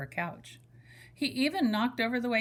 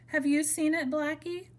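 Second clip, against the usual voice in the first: bunny voice; 7 semitones higher than usual. Acted. Bunny Blue asks Blackie.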